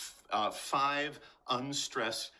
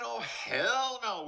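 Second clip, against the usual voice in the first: high, drawn-out indignant no; emphatic refusal; 12 semitones higher, 3 dB louder, slower than usual. Acted. Teacher refusing to deny Shakespeare's rhythm, indignant. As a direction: play up the outrage, stretching it high. Mock outrage at denying the rhythm.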